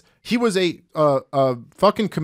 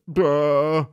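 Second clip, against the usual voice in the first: a blank, dopey "duh"; mock clueless grunt; dumbfounded student. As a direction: play the stumped student, lightly. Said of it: "And they'd be like".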